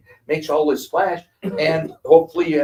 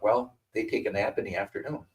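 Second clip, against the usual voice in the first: quiet scheming; 3 semitones lower, 7 dB quieter than usual. Retold or imitated. Kids plotting behind parents.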